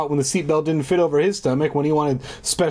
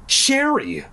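shocked; 12 semitones higher than usual. Acted. Shocked at sight.

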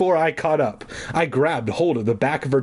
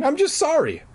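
a lightly voiced girl, pitched higher. Margaret apologizing to Cooper in a higher girlish voice. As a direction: speak as a timid young girl. Girl's apology in read story.

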